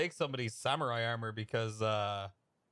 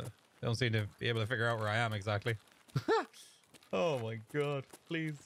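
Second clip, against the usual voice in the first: ordinary talk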